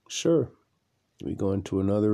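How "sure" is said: "Sure." popped up much higher, light and carefree; an unbothered clerk tossing off a yes; breezy clerk. Retold or imitated. Motel clerk answering the complaining guest, breezy and high.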